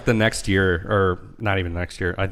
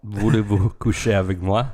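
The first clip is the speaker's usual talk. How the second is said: put-on French accent; 3 dB louder than usual. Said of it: "trying to speak French".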